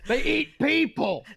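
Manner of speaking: high, slow whisper shout; squeaky Danny DeVito whisper yell. Retold or imitated. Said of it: "Danny DeVito's just whisper shouting at us".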